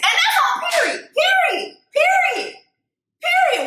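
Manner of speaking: high-pitched, drawn-out "period"; emphatic exclamation. Real, not acted. Reacting to a rap lyric, emphatic and high-pitched. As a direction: sing out each word way up high.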